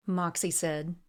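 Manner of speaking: plain reading voice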